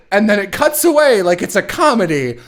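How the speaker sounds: usual speaking voice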